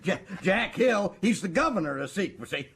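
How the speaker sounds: gloating cackle breaking through the words; high giddy laughter between gloating boasts; gleeful laughter. Acted. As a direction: laugh with smug triumph while talking. Gloating over the captured convict.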